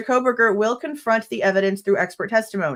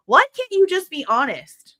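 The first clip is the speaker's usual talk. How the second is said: much higher, pleading in frustration; exasperated; 10 semitones higher than usual. Real, not acted. Fed up with the state, begging them to be honest. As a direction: throw up your hands and plead.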